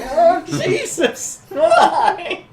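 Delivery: high, loud mock screams; hammed-up shriek with laughter around it; screaming bystanders. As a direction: scream it drawn out, playing a panicked crowd. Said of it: "people screaming".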